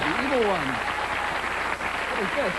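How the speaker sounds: high, eerie echo of the clip; hammed-up spooky psychic voice; mock spooky voice. Mimics the clip's evil voice.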